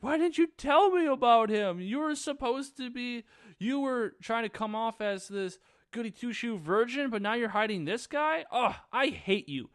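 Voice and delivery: high-pitched, hammed-up angry tirade; indignant, starting loud and staying high; pissed-off rant. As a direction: imitate a betrayed friend telling someone off. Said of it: "she's pissed".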